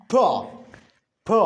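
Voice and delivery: P as a short "puh", not the letter name; isolated "puh" for P; consonant sound demo. Shown as a demonstration. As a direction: sound out P with a brief vowel after it. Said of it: "you utter a consonant sound".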